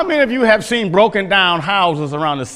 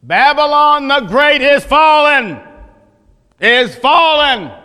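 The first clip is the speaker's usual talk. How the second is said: loud, higher proclamation, the second "is fallen" slowed; mighty angel's cry; 7 semitones higher, 4 dB louder, slower than usual. Pretend. Preacher voicing the angel crying to the earth, loud and ringing. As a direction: cry it out like an angel announcing doom. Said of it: "He cried mightily with a strong voice".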